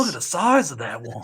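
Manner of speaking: put-on a light, lightly done Australian accent in the style of Steve Irwin; Steve Irwin impression. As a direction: give a hint of Steve Irwin's Australian accent without going far from your own voice. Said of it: "my best Steve Irwin impression".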